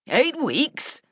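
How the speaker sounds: high, alarmed near-shout. A narrator's reading. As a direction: blurt it out in disbelief. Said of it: "almost shouting".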